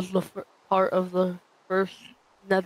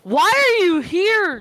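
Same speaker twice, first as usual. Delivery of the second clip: high, loud, drawn-out cry; startled yell; 12 semitones higher, 7 dB louder, slower than usual. Real, not acted. Player yelling at an intruder in the game, startled wail. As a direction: wail at the unwelcome visitor.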